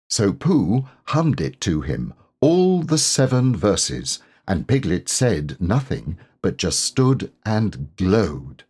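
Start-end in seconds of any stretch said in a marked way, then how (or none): none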